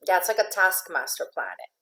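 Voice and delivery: ordinary talk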